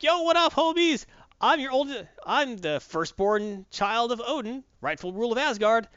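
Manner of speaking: high, swaggering mock entrance; Hela. Hela shows up to claim Asgard, cocky mock proclamation. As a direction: announce yourself with hammed-up cocky grandeur. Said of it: "She's like".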